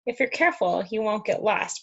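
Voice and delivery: ordinary delivery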